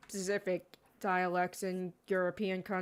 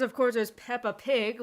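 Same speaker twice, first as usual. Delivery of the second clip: usual speaking voice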